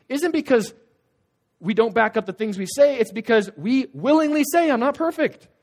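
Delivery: loud, high preaching build; fiery preaching. Acted. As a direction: preach it out to the crowd. Preaching at full force.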